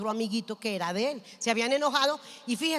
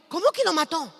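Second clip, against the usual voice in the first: higher-pitched, startled question; acted shock; 4 semitones higher, 4 dB louder than usual. Mother hearing her son's news, shocked disbelief. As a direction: blurt it in retold disbelief.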